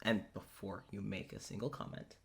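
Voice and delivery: very quiet, halting and low; hushed warning. Acted. Claudius warns Richard before he can comment, hushed. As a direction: break it to them softly, with long pauses.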